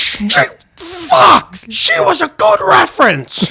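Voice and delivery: very high screamed curse and complaint; imitating a friend's screaming outrage; retold scream. Friend over the prank email to his boss, high scream. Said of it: "screamed at us".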